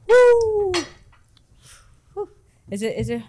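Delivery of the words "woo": excited whoop. Real, not acted. After taking the drink.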